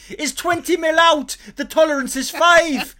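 high, loud Welsh snagging inspector; Welsh builder impression. Impression of the Welsh snagging guy tutting at a new build. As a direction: pick apart a shoddy build in Welsh. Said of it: "You're very good at an impression of him".

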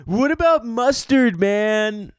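high, slow stoner drawl; hippie voice. Retold or imitated. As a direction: play a spaced-out protester asking earnestly. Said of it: "people would just walk up to him and be like".

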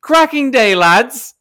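high, loud, hearty hail; cheery greeting. Acted. Rider to fellow bikers in the car park, cheery greeting. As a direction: call out jovially to the group.

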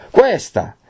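high, accusing outburst; fierce cry. A narrator's reading. Renzo points at his betrothed, crying out fiercely. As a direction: cry it out like a verdict. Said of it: "e gridò".

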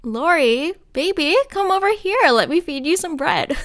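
high, eager hammed-up swoon over Laurie; mock lovesick gushing. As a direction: gush at him like a smitten fan. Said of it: "I totally would have been like".

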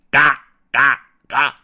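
loud cawing crow. Acted. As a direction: caw like a crow. Crow on the roof caws. (乌鸦嘎嘎叫)

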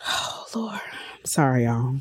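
tearful, soft near-whisper; choked up. Real, not acted. Apologizing to viewers while overcome, choked up. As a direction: overcome with emotion, voice dropping away. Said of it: "I'm getting emotional".

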